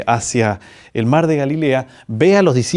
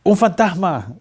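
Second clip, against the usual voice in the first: exclamación aguda de susto fingido; grito asustado imitado; 6 semitones higher, slower than usual. Retold or imitated. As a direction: imita a los discípulos gritando de miedo. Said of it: "y gritaron". (susto actuado, en tono más alto)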